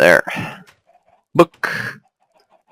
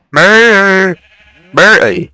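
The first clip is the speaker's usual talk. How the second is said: silly high brr; 10 semitones higher, 3 dB louder than usual. Pretend. Playful noises at the animals.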